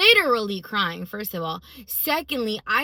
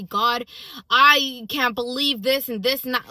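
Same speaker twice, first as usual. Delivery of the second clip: loud, raised-pitch imitation of griping; mock complaint; 4 semitones higher, 8 dB louder than usual. Imagined griping to God she didn't do, mock complaining. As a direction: complain emphatically, hitting the first words hard.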